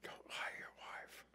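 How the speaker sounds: whispered aside to the room; a confiding whisper to the crowd. Real, not acted. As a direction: drop to a breathy whisper, half joking. Aside warning to the congregation.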